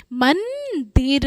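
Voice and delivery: मंदिर slowly split, long humming n after म; nasal anusvar demo. Shown as a demonstration. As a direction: hold मं in the nose, then दिर in मंदिर. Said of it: "माँ पे अंग निकल रहा है ना".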